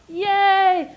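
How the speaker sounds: high, loud mock cheer. Lecturer cheering for the hero, high and loud. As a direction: cheer like a fan at the movies.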